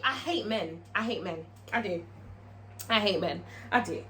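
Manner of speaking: low, quiet grumble; dropped-voice muttered confession; deflated aside. Real, not acted. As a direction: say it softly to yourself, fed up. Muttered aside after the rant.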